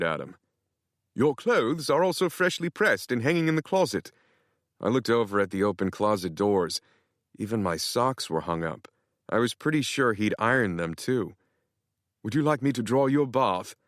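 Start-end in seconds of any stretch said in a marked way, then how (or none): 1.1-4.1 lightly British, a touch higher and coy; hint of a cheeky British houseboy; sly British butler. Nigel the houseboy speaking. 12.2-13.7 lightly British, a touch higher and coy; hint of a cheeky British houseboy; sly British butler. Nigel the houseboy speaking.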